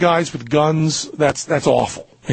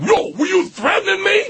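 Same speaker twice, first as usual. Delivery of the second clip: mock shouted challenge, pitched way up; tough confrontational challenge; 13 semitones higher than usual. Shown as a demonstration. Showing how he did not confront the stranger, mock shout. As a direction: shout it like someone picking a fight. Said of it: "And I'm not saying, like".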